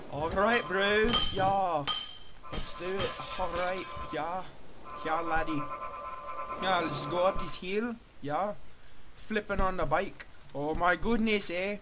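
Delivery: put-on vague Australian-Irish-Scottish accent, lilting; muddled foreign accent. Gamer reassuring a bro, in a muddled Australian-Irish accent. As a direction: put on an unplaceable accent, bouncy intonation. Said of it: "like Australian and, and Irish and Scottish".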